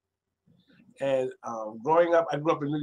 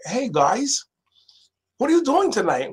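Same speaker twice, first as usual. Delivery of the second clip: bright higher eager greeting, getting louder; square friend's voice; 9 semitones higher, 5 dB louder than usual. Retold or imitated. Chucky cheerfully asks the gang their Friday plans, higher. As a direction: play the earnest square kid asking the gang's plans.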